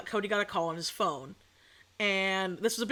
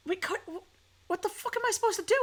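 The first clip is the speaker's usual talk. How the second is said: pitched way up, halting then rushed; high panicked squeak; 10 semitones higher than usual. Retold or imitated. Girlfriend panicking at being told to jump the gate, high squeak.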